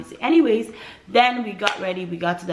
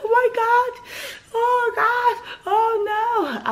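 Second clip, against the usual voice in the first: high, loud pained wail, near crying; crying out in labor pain; 4 dB louder than usual. Retold or imitated. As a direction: cry out as the contraction hits. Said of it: "I was yelling".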